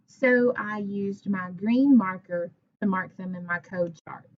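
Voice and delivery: ordinary talk, nothing marked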